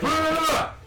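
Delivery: high, loud one-syllable command; sharp command shout. Retold or imitated. Cop calling off his dog, sharp high shout. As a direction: bark a single sharp stop at the dog.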